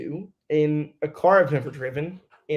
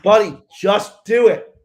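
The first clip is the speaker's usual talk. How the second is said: insistent urging; 6 semitones higher, 9 dB louder, slower than usual. Retold or imitated. Crew chief insisting on radio.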